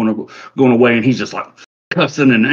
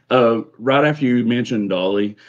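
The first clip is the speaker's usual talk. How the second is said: ordinary delivery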